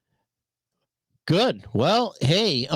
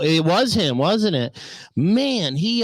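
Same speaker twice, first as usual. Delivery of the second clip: normal talking voice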